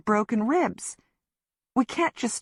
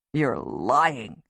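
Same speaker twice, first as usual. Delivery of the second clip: low, hurt accusation; lower, wounded voice; 6 semitones lower than usual. Acted. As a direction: say it like he's been betrayed. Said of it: "in a wounded voice".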